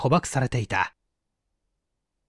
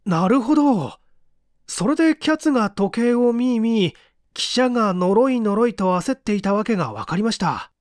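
put-on eager young assistant; 7 semitones higher than usual. Young assistant voiced higher.

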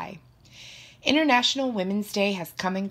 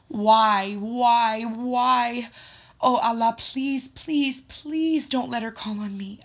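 high, pleading plea to God; desperate prayer; 6 semitones higher, 4 dB louder, slower than usual. A narrator's reading. Student prays teacher won't call on her, desperate pleading. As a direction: beg hard, higher than usual. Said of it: "I silently pray".

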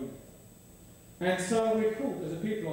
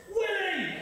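much higher mock toddler yell through laughter; 10 semitones higher than usual. Toddler shouts a new word across the park, high mock yell. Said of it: "Top of her voice".

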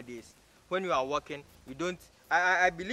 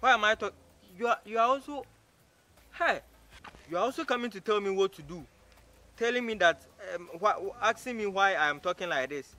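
higher-pitched, offended retort; indignant; 4 semitones higher than usual. Acted. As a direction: challenge them, voice raised in pitch. Offended man confronts the woman asking for help.

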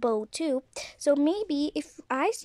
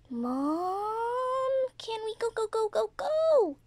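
put-on whiny kid voice; 8 semitones higher, slower than usual. Child toy begs mother.

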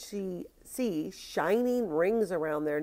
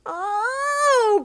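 high, loud, drawn-out cry; boy's cry; 9 dB louder than usual. A narrator's reading. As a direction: cry out high as a startled little boy. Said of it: "cried the little boy".

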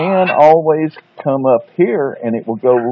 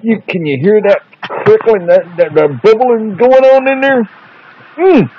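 put-on excited kids show host; 7 semitones higher, 3 dB louder than usual. Excited host over the pan.

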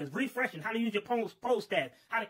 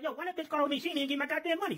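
annoyed grumble; 7 semitones higher, faster than usual. Retold or imitated. Worker grumbles about unpaid boss.

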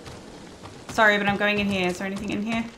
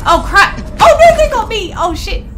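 high, loud panicked cry; panicked shout; 10 semitones higher, 6 dB louder than usual. Real, not acted. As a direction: yelp as they get you. Getting killed in game.

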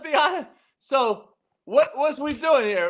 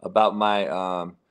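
much lower, trailing mumble; self-aware mumbling; 12 semitones lower than usual. Real, not acted. Host admitting he sounds Chinese, low mumbling. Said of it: "I'm sounding Chinese right now".

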